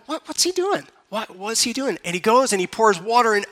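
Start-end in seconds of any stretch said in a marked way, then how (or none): 0.1-2.0 puzzled disciple. Acted. Disciples' confusion acted out.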